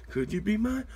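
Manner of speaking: normal talking voice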